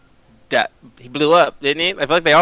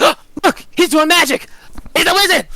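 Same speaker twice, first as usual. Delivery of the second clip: very high, gasping mock alarm; fake shock; 4 dB louder than usual. Penny framing a goblin as a wizard, high exaggerated alarm. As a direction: squeal the accusation in pretend horror. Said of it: "and I'm like".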